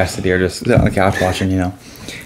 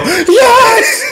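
much higher, louder laughing cry of yes; triumphant yell; 5 dB louder than usual. Retold or imitated. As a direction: relive the joy of the win. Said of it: "I go".

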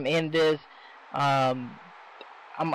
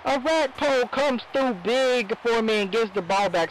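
excited; 7 semitones higher than usual. Real, not acted. Interception swings the game.

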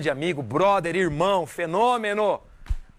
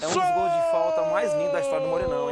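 excited shout; 11 semitones higher than usual. Real, not acted. Host hyped about the goal.

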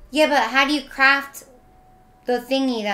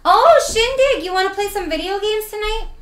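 much higher and louder hello; excited greeting; 9 semitones higher, 3 dB louder than usual. Real, not acted. Streamer spots a friend in chat, excited greeting. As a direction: call out happily to a friend.

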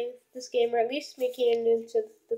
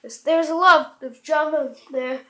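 ordinary delivery

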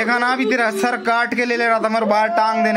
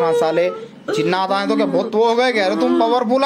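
ordinary delivery

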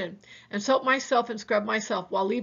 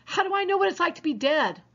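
higher, exasperated cry of "How do I know"; exasperated outburst; 8 semitones higher than usual. A narrator's reading. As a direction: snap back at a brother's questions, voice pitched up. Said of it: "You don't have to scream at me".

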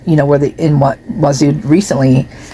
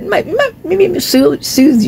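playful high voice; 12 semitones higher, slower than usual. Real, not acted. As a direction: tease the listeners sweetly. Cat purring near phone.

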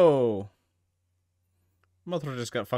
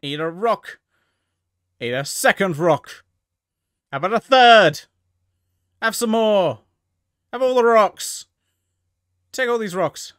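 building to loud high gleeful shouts; mock taunting yells; 7 semitones higher, 12 dB louder, slower than usual. Cheering on the rock-throwing kaiju, building yell. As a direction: pelt him, getting louder with each rock. Taunting monster hurling rocks.

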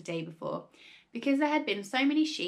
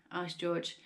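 plain reading voice

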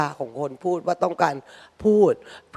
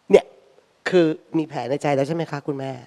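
normal talking voice

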